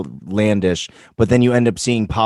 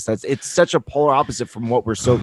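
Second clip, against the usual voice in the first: ordinary talk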